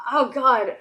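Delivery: usual speaking voice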